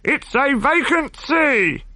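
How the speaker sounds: very high, slow call from the masthead; high, drawn-out sailor's call down; lookout calling down. A narrator's reading. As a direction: sing out from aloft. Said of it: "the man called down".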